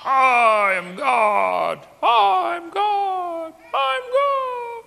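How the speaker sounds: drawn-out loud boast climbing into squeaky falsetto; self-deluded would-be god. Acted. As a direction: start in a big slow shout, end absurdly high and loud. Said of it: "People get this idea".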